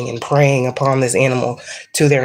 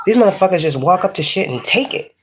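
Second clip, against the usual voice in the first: ordinary talk, nothing marked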